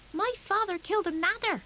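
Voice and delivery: put-on high, eager small boy's boast; a small hedgehog bragging to his cousins; little hedgehog voice. Little hedgehog boasting to his cousins, high and eager. Fuzzy Peg's boast, child voice.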